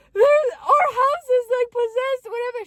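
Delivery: mock tearful voice, high and loud; imitated high-pitched crying; crying sister. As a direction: wail it like a hysterical sister. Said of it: "She's crying, she's crying".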